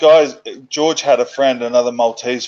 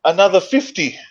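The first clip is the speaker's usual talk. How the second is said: imitating the strongman; 7 semitones higher than usual. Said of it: "He goes".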